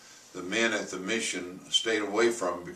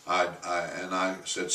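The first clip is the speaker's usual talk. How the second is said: ordinary talk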